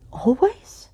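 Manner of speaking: high whispered surprise; surprised echo. Acted. Casper surprised by the question, whispering. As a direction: breathe the word out in shock.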